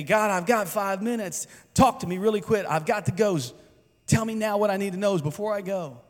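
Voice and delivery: a busy churchgoer hurrying God along; hurried mock prayer. Impatient worshipper rushing God.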